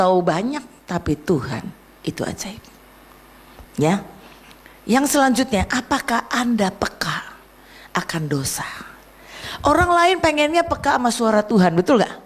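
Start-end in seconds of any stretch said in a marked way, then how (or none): 5.7-8.7 a dropped, whispered question to the room; searching whisper to the listeners; hushed question. Acted. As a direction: lean in and whisper it. Probing question to the congregation.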